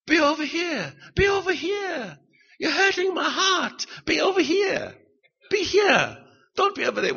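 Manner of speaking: high-pitched imitation Chinese-accented teacher; Chinese English accent. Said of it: "her Chinese English accent".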